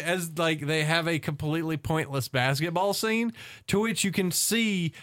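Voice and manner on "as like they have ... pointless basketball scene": usual speaking voice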